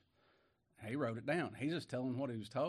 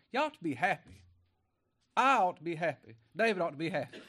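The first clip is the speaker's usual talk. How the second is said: raised, ringing preacher's exhortation; fiery preaching; 6 semitones higher, 6 dB louder than usual. Acted. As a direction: urge them to joy with full force. Preacher exhorting the flock to rejoice.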